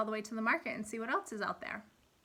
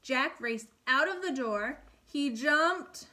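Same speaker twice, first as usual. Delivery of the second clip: put-on lively storybook reading for kids; animated read-aloud for first graders; reading to children; 3 semitones higher than usual. A narrator's reading. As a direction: read it like a picture book at storytime. Teacher reads fairy tale aloud.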